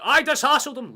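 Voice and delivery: sudden high, very loud yell; shouting. Real, not acted. Shouting whenever Ida does something in the game, high loud yell. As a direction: blast it out like a match call. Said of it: "Just shouting at any time Ida".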